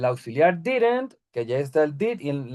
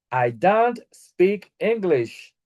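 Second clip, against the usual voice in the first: slow model sentence; 2 semitones higher, much slower than usual. Shown as a demonstration. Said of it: "teacher models example sentence".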